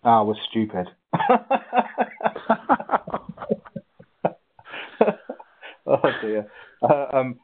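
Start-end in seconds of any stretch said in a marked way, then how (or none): 1.1-3.5 high burst of laughter at himself; amused self-mocking laugh; real laugh. Real, not acted. Laughs at own answer.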